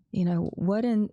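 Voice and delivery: ordinary delivery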